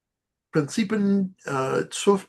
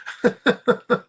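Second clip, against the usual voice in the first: laugh; 9 semitones higher, 4 dB louder than usual. Real, not acted. Laughs at joke remark.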